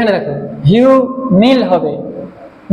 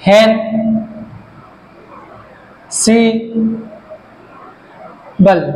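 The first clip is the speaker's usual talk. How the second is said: "ইন, কম, pre, হেন, Sibal" with very long pauses; syllable-by-syllable dictation; much slower than usual. Shown as a demonstration. Teacher dictating syllables, "ইন, কম, pre" each standing alone. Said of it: "syllable আছে".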